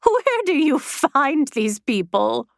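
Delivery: high, slow, disdainful; scornful aristocratic sniff; haughty. A narrator's reading. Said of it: "said Triffine haughtily".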